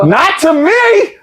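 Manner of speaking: high, drawn-out mock protest; playful slow squeal of objection; indignant. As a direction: stretch it out high in comic outrage. Acted indignant comeback.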